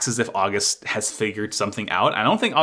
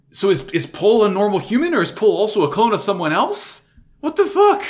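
higher, building to a shocked yelp; incredulous; 8 semitones higher, 4 dB louder than usual. Real, not acted. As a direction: spiral into disbelief. Mind racing about clones.

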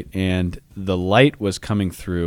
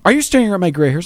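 higher, faster accusing wife-friend voice; quoting Andrea; 10 semitones higher, 4 dB louder, faster than usual. Retold or imitated. Telling his friend how Andrea caught him staring, higher and faster. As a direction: snap the question up high and quick. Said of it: "and she goes".

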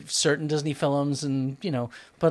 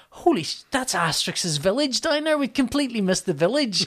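high-pitched, astonished retold outburst; excited realization; 8 semitones higher than usual. Spotting the village they completely missed, excited realization. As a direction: re-enact spotting it with rising excitement.

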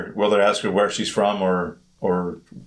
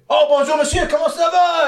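very high, louder mock French vendor; vendor's French; 4 dB louder than usual. Vendor answering the speaker in French, very high and eager. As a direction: gush in French like a delighted seller. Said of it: "And he'd say".

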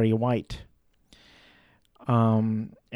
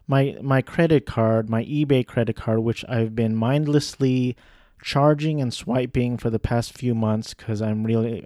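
normal talking voice

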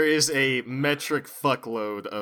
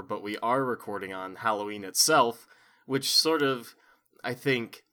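ordinary talk

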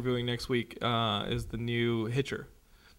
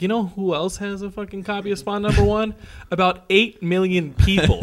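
higher and louder, mocking the question; mock exasperated retort; 7 semitones higher, 7 dB louder than usual. Scoffing at a comic collector, mock exasperated. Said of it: "And I'm like".

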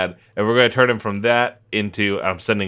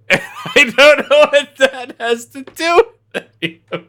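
laughing disbelief; 6 dB louder, slower than usual. Real, not acted. Amused disbelief at listener comment.

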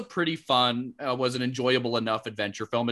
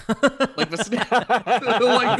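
high laughing disbelief; 7 semitones higher, 7 dB louder, much slower than usual. Real, not acted. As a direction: laugh through the words. Laughing at absurd image.

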